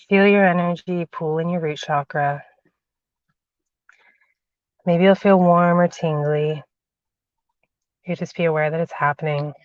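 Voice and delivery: slow, soft meditation voice; guided meditation. Acted. Guiding viewers through a grounding meditation, slow and soft.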